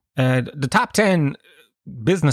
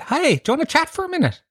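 high, fast, chirpy charity mugger greeting; mock chugger pitch; faster than usual. Comic bending a passerby's ear, high eager chugger voice. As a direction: sing it up high like an eager street fundraiser.

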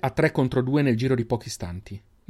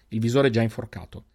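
usual speaking voice

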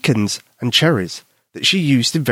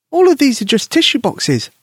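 put-on higher child voice calling down; high girl calling down; 12 semitones higher, 3 dB louder than usual. Susie calling down from the chair, high girl voice. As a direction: call down from the shelf. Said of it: "Susie called down to Albert".

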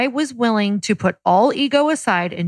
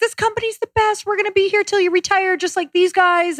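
high, giddy sing-along enthusiasm; quoting starry-eyed company fans; mock gushing; 11 semitones higher than usual. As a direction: gush naively in a much higher voice. Gushing about trips and job.

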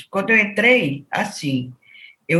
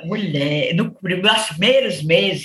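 usual speaking voice

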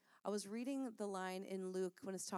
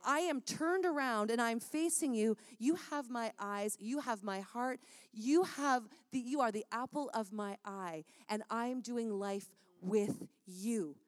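louder, emphatic sermon voice, speaking as God; impassioned preaching; 2 semitones higher, 4 dB louder than usual. Acted. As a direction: preach it with rising force and deliberate stresses. Preaches in God's voice, building.

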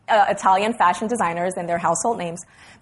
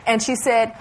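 ordinary talk

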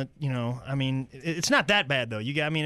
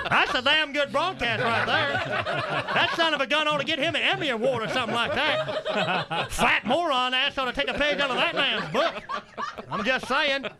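higher-pitched ranting uncle voice; worked-up comic uncle; gruffly opinionated uncle; 11 semitones higher than usual. Acted. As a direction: sound off like an indignant old fan. Uncle praises his imagined broadcast.

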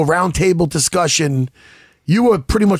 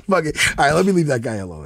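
usual speaking voice

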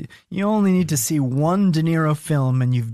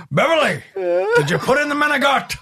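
Pacino voice; 12 semitones higher than usual. Retold or imitated. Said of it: "Pacino voice to Beverly".

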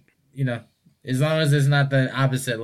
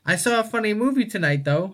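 lightly voiced mom, much higher; higher-pitched casual mom imitation, easing down; mom's aside; 8 semitones higher than usual. Mom changing the subject with her child, lightly imitated aside.

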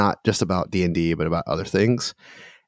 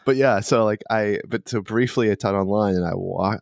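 ordinary delivery

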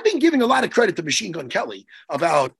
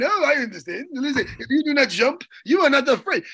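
mock French accent; 5 semitones higher than usual. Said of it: "whatever French guy was sipping his wine going".

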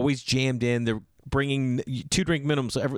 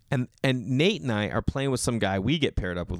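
ordinary talk, nothing marked

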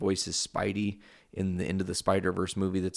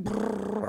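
high imitated cat trill; 13 semitones higher than usual. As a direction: roll out a high, purring brrr. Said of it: "Cammy's just like".

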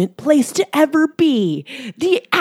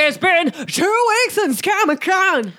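high, hyped announcer call; excited shout; 6 semitones higher than usual. Shown as a demonstration. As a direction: shout it like a convention hype man. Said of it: "No, that was bad".

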